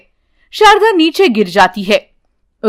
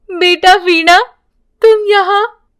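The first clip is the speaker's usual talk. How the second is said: surprised mother; 7 semitones higher than usual. Acted. Mother wakes, sees daughter.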